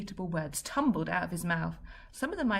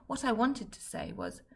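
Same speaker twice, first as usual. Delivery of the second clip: usual speaking voice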